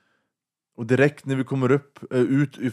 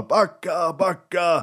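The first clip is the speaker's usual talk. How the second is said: retold yell; 7 semitones higher, much slower than usual. Hooligan yelling at his crew to retreat, a retold yell. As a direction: shout it like a warning across the street.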